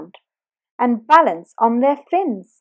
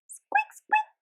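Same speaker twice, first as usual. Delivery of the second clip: very high soft squeaks; tiny high dolphin squeaks; 5 dB quieter than usual. Retold or imitated. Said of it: "hearing them trying to speak".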